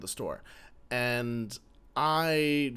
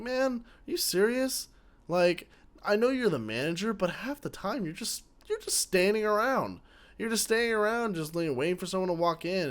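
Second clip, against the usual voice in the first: higher-pitched, exasperated retold rant; pissed off; 8 semitones higher than usual. Inner rant at manager Josh, high and exasperated. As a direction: vent at the boss in a raised, fed-up voice. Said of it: "At this point, I'm pissed".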